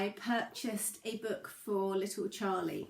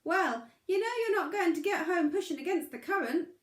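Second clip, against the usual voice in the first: high, louder crayfish giving advice; wise crayfish; 9 semitones higher, 5 dB louder, faster than usual. Acted. Crayfish advises the struggling pebble, high wise voice. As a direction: speak up like a small wise creature. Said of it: "said the crayfish".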